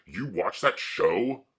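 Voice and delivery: mildly hammed-up incredulous question; slow, rising mock question; mocking classmates. Imitating schoolmates scoffing at the X-Men movie, mocking question. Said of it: "And they'd say".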